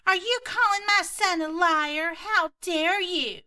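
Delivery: hammed-up entitled mom, slow and high; entitled Karen.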